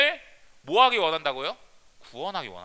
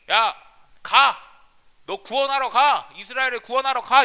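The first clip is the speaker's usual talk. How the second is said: commanding shout; 3 semitones higher, 7 dB louder than usual. Retold or imitated. God commanding Gideon.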